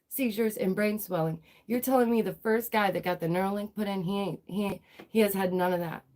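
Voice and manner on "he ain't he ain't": ordinary delivery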